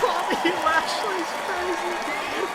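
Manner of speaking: high, slow drawn-out awe; amazed. Real, not acted. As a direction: stretch it out in disbelief. Amazed at wrestler on stage.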